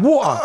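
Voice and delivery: put-on "Water" offered up in his home accent; his own way of saying water. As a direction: say "Water" as the accent that amused her. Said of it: "look at the way he says water".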